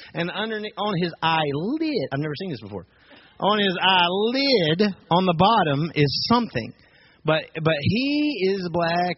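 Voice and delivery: ordinary delivery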